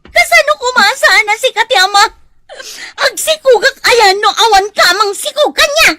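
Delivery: loud, high wailing sobs; sobbing outcry. Acted. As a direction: shout it through heavy sobbing. Rejected pregnant woman sobbing at lover.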